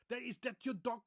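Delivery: put-on high, loud Dutch-accented question; exaggerated Dutch English, dog ends in k. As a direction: play a Dutchman asking in English. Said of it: "When a Dutch person speaks English".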